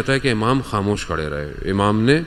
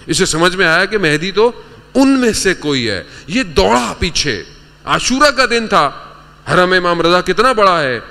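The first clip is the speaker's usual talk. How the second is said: high, urgent sermon delivery; impassioned preaching; 9 semitones higher, 5 dB louder than usual. Real, not acted. Preacher recounting a sick man's run on Ashura, impassioned. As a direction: preach it with mounting fervour, voice pitched up.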